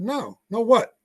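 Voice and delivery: high, puzzled questioning; retold confusion. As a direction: act out being completely lost. Retelling his puzzled reply to her.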